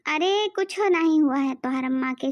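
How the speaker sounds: ordinary talk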